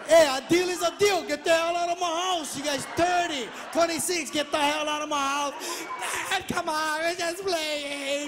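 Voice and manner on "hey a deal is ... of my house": loud, playful get-out; mock eviction. Father loudly ordering sons out of the house. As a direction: bellow them out, half joking.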